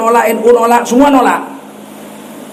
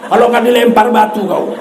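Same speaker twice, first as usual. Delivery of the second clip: ordinary delivery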